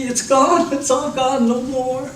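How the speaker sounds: high, sing-song comforting; reassuring baby. Retold or imitated. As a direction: promise him it's over, gently. Parent soothing baby after cleanup.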